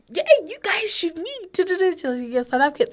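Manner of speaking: high-pitched, eager mock matchmaking; excited introduction. Party friends urging two South Africans to meet, excitedly. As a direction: imitate party friends excitedly pairing people up.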